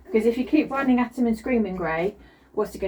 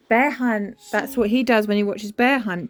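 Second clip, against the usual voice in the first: ordinary talk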